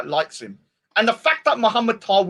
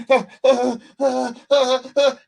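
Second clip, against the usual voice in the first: high mock oh oh moans; lightly voiced whimpering oh's; mock moaning; 4 semitones higher, slower than usual. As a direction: imitate him moaning mid-exorcism. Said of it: "He's like".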